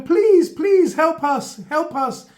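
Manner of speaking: high, loud desperate pleading that fades on the last help us; pleading biscuit voice. Pretend. Biscuits left in the cupboard beg to be saved, high pleading. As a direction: beg to be rescued like trapped little biscuits. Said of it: "you hear them going".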